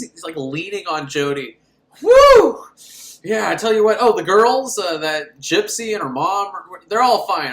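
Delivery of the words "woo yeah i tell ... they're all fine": loud, high whooping brag; hammed-up triumphant report; hyped ice cream man. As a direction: boast like a cocky sidekick.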